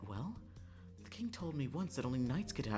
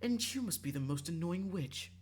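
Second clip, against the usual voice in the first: higher, bickering comeback; irritated retort; 6 semitones higher than usual. Acted. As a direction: throw the insult straight back. Acted retort to the insult.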